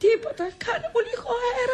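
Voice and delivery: very high, sustained invalid wail; hammed-up dying whine; feigned heart attack. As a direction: fake being stricken with pains. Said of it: "Έχω ανάγκη από μια καρδιακή κρίση".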